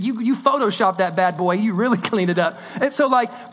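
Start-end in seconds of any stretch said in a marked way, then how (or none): none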